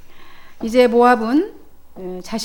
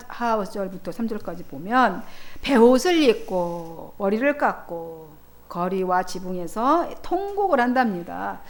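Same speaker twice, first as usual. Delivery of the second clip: usual speaking voice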